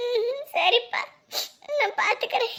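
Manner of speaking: high, sobbed assent; tearful crying. Acted. Mother reassuring her departing son, crying. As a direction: agree through sobs. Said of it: "நீங்க அலறதுனால அப்ப அவரும் அழுகுறாரு".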